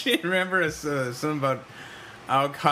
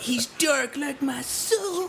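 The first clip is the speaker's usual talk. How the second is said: mock brooding goth; 12 semitones higher, much slower than usual. Friend mocking the Crow film, slow mock brooding. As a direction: play the tortured Crow hero.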